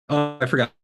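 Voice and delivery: choppy dropping audio; words cutting in and out; bad connection. Real, not acted. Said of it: "You're breaking up".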